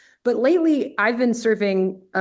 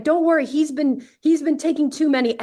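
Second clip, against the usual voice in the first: high, eager reassurance; lightly raised, urging voice; reassuring egging on; 7 semitones higher than usual. Retold or imitated. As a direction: push her on, up and keen. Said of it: "And I'm like".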